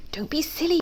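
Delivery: soft, high hushed voice; whispering. A narrator's reading. Duck hushing Pig about the fox, whispering. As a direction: keep it down, secretively. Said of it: "Piggy whispers".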